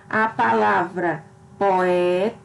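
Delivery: "poeta" said slowly with its sounds run together; slow, careful saying of "poeta"; showing the sound union; sounds joined. Shown as a demonstration. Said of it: "então eu digo esse som unido ao outro".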